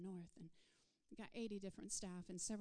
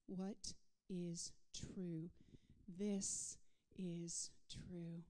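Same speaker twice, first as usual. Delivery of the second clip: slow emphasis; 3 semitones lower, much slower than usual. Acted. Preacher poses question and answers.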